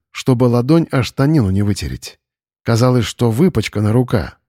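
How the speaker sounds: ordinary delivery, nothing marked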